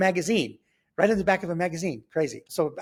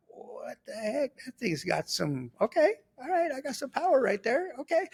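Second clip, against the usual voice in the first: amazed realization; 10 semitones higher, 3 dB quieter than usual. Retold or imitated. Boy realizing his punch hurt, high-pitched amazement. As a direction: act out a dawning, pleased discovery.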